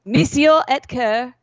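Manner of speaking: Monsieur Ecker said slowly with a hammed-up French accent; drawn-out mock French Monsieur Ecker. Shown as a demonstration. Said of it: "You gotta stop saying that".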